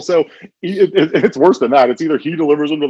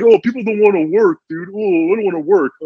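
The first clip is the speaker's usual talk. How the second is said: mocking whine; 10 semitones higher than usual. Mocking a lazy coworker.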